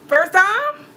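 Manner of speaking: high, loud disbelief; can't believe it was the first time; incredulous. Real, not acted. Telling friends about the first time, incredulous.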